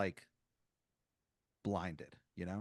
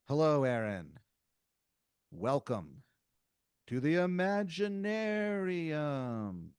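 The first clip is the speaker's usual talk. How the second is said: grand greeting; 4 semitones higher, slower than usual. Retold or imitated. Helmeted dude greets Aaron.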